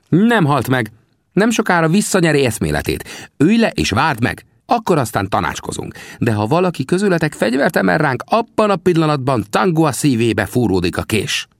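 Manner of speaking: high-pitched scout character; Sam, commanding. A narrator's reading. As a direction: warn them in a high, firm voice. Sam reassures, then threatens. (magas, parancsoló figurahang)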